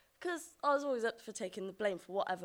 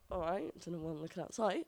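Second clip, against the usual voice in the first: normal talking voice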